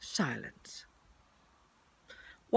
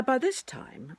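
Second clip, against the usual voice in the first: usual speaking voice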